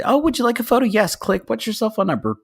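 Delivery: high, chirpy photo booth patter; singsong customer-service voice, high; chirpy attendant. Retold or imitated. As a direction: pitch it up high like an eager attendant. Retold pitch to photo booth guests.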